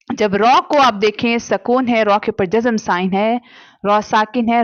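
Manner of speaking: normal talking voice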